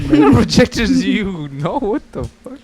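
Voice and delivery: high, slow mocking repeat; mocking disbelief. Real, not acted. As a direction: scoff at the word. Mocking friend's word.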